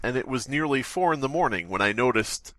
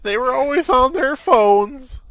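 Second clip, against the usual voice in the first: character voice; 13 semitones higher, 5 dB louder, slower than usual. Acted. Character line, high loud slow.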